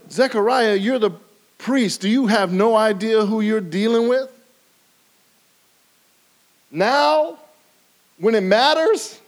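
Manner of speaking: loud, high rebuke of Zechariah; rebuking angel. Retold or imitated. Gabriel confronting the doubting priest, loud and high. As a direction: scold him, astonished he doesn't get it. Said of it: "It's almost as if he said".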